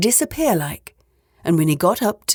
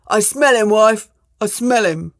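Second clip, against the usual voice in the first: insistent, raised ogre voice; ogre's cry; 3 semitones higher, 4 dB louder than usual. A narrator's reading. Ogre insisting to his wife he smells someone, raised voice. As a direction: call it out as a suspicious giant. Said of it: "cried out the ogre".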